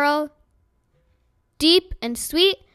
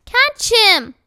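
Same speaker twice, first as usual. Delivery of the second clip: loud, high cry for help; boy calling urgently as the rabbit rises; 8 semitones higher, 7 dB louder than usual. A narrator's reading. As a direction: call out to grab him. Edward flying away, urgent call.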